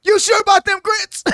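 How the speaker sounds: high, loud, put-on Brooklyn lawyer voice; Vinny impression. Quoting the courtroom scene from My Cousin Vinny, loud high impression. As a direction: needle the witness like Vinny. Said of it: "Vinny is asking the guy about them grits".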